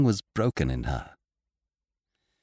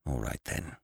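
low, very soft, sinister surrender; strange, malicious; 6 semitones lower, 11 dB quieter than usual. A narrator's reading. Beaten man giving in to the girls, quietly malicious. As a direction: give in quietly with hidden malice. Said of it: "His voice was strange and full of malice".

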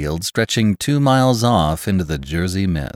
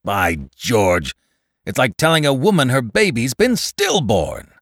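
loud, high, indignant swearing; bitter outraged complaint; angry; 5 semitones higher, 4 dB louder than usual. A narrator's reading. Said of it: "swore again".

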